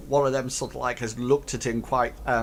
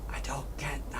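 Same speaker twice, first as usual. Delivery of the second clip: whispered aside; 12 dB quieter than usual. Acted. Goblin whispering confusion while leaving. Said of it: "you hear one says".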